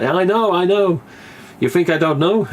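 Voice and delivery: high, laughing retold protest; a touch of mock exasperation; laughing mock defensiveness. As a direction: play it defensive through a laugh. Retold actor's defensive reply.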